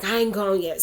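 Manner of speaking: frustrated retort. Retold or imitated. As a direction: snap back in frustration. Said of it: "I was getting so frustrated".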